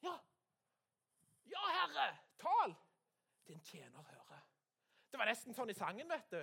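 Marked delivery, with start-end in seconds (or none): 0.1-4.4 high, loud, eager reply; Samuel answering God. Acted. Boy Samuel answers the Lord, loud high call. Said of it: "svarte Samuel da".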